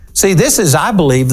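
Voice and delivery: ordinary talk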